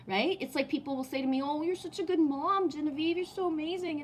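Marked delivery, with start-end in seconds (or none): 1.4-4.0 mock gushing praise. Quoting people praising her mothering.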